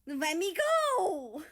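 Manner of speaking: high, loud and slow squirming cry; playful protest. Acted. Japan squirming in America's arms. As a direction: wriggle free, half laughing.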